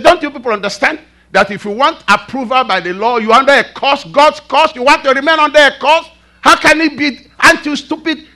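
acted outrage, high and loud; Paul, very furious. Preacher voicing Paul rebuking the Galatian church, furious. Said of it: "very furious".

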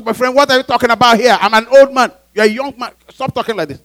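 loud, high-pitched scolding; Peter's stern reprimand. Acted. Peter scolding Jesus as a younger man, loud reprimand. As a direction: tell him off at full voice. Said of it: "Peter is reprimanding Jesus".

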